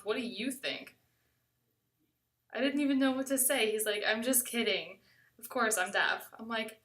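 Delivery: usual speaking voice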